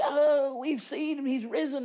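high and loud, bursting with news; excited disciples. Acted. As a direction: blurt it out overjoyed. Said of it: "as they were all excited".

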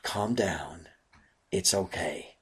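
soft and low, gently soothing; hushed, loving reassurance; loving calm. Acted. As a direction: ease their fear with a quiet word. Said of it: "a loving challenge to them".

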